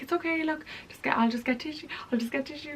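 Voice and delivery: high, flustered "it's okay"; panicked reassurance. Retold or imitated. To the date who spilled coffee, flustered and high.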